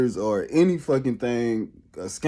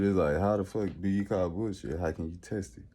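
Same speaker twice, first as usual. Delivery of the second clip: usual speaking voice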